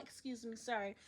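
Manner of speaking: quiet courteous excuse; soft polite apology. Retold or imitated. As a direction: murmur it politely while passing. Squeezing past lady politely.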